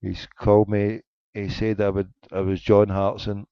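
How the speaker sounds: usual speaking voice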